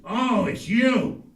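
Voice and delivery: grumpy dad. Retold or imitated. The dad answers the phone.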